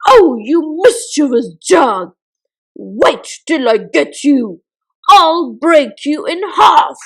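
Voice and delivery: loud, furious scolding of the jug; angry scolding. Acted. Miggle yelling at the jug pouring treacle on his washing. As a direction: tell the naughty jug off at full volume.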